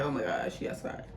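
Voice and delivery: hushed panic. Retold or imitated. Panicked aside about mama arriving.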